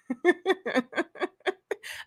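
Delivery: high, soft laugh; real laughter. Real, not acted. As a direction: laugh at the compliment. Laughs at joke.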